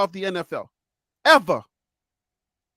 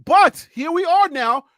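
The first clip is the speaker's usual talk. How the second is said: ordinary delivery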